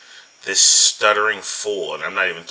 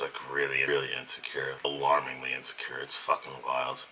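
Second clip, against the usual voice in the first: whispered; 5 semitones lower, 9 dB quieter than usual. Real, not acted. Commentator aside.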